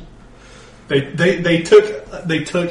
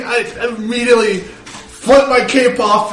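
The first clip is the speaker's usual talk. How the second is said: ordinary delivery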